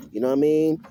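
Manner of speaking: ordinary talk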